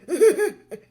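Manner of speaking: high, squeaky mock sobbing; stifled ugly cry. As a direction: imitate crying while trying to hide it. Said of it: "me trying to hide".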